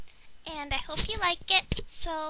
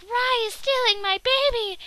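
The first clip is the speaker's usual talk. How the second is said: louder, higher complaint; outraged cry; 5 semitones higher, 7 dB louder than usual. Pretend. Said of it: "Conjo was like".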